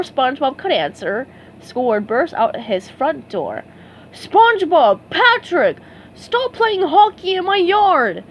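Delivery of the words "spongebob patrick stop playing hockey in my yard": higher, louder furious shout; fed-up neighbor; angry yell. A narrator's reading. Squidward yelling at the boys, furious shout. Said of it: "he yelled".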